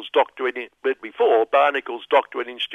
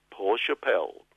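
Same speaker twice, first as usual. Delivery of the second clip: usual speaking voice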